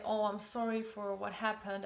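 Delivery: normal talking voice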